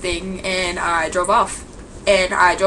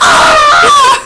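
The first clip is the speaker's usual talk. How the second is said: shrill high shriek of horror, very loud; a sudden piercing yelp; horrified scream; 13 dB louder than usual. Retold or imitated. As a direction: scream like you've just seen something awful. Shocked at fat fiance photo.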